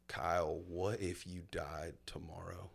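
normal talking voice

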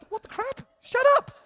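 high, yelled disbelief; shocked outburst. Retold or imitated. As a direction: blurt it out as if caught. Shocked reply to friend's guess.

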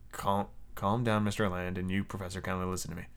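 very soft, low, under the breath; tentative accent rehearsal. Shown as a demonstration. Reader privately rehearses a French accent, hushed tryout. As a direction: try the line out quietly to yourself. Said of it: "I'm so bad at French accents".

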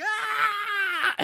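high-pitched mock scream; imitated scream. Kid doing a Leatherface chainsaw dance, imitated scream. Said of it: "And he screams".

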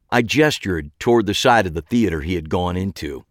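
usual speaking voice

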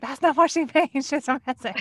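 loud, high, laughing retelling of a protest; retold protest. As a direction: blurt it out loudly through laughter. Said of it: "she was like".

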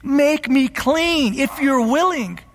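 desperate begging, acted by the preacher. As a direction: plead for it, high and drawn out. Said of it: "starts begging Jesus".

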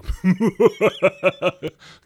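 villain's laugh, high and loud; put-on cartoon villain chuckle; Destro. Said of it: "he has that kinda".

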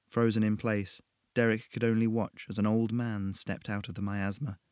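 usual speaking voice

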